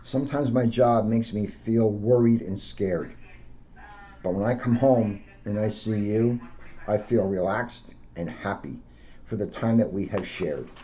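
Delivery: gentle storybook read-aloud; a police officer reading to children. Acted. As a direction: read it out to kids like a picture book. Officer reads picture book aloud.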